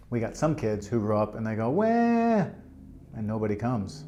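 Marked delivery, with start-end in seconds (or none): 1.7-2.5 high, held mock cry; imitated infant wail on one long note; baby's wail. Teacher acts out a baby nobody comes to, mock wailing.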